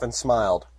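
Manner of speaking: normal talking voice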